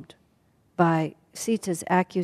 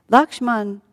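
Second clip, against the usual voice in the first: ordinary delivery, nothing marked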